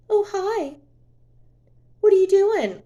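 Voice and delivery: high, sing-song fake casual greeting; mock innocent ex. Vlogger mocking her ex pretending all is fine, sing-song. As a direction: imitate him acting like nothing happened.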